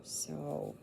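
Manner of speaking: ordinary talk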